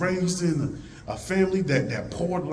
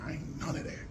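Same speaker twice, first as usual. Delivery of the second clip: Harriet defiant; 2 semitones higher, 13 dB quieter than usual. Retold or imitated. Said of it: "Harriet used that same voice".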